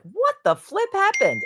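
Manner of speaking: high-pitched, incredulous outburst; shocked disbelief. Retold or imitated. Reenacting her own reaction to something crazy, shocked disbelief.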